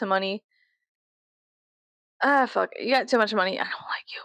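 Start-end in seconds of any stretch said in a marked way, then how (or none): 3.6-4.2 soft unvoiced dislike; whispered. Shown as a demonstration. Telling rich people she dislikes them, whispered. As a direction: breathe it out quietly. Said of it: "contrasted takes".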